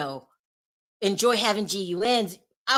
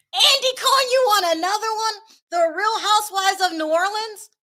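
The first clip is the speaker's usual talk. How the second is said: high, loud mock disbelief; theatrically scandalized questioning; incredulous outburst; 10 semitones higher, 5 dB louder than usual. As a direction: call him out in exaggerated amazement. Incredulous address to absent TV host.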